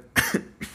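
sudden dry cough. Real, not acted. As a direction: cough hard, mid-sentence. Coughing fit mid-story.

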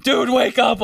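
high shout to wake a passed-out friend; urgent shout. Retold or imitated. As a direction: yell at him to get up now. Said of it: "And they're like".

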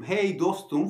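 mock buddy greeting. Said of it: "falan yapıyorum yani".

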